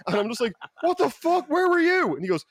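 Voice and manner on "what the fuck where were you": high, loud disbelieving shout; shocked yell. Retold or imitated. Panicked friend yelling at Andy as he walks in, high and loud. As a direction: yell it at the friend you thought was dead, way up high.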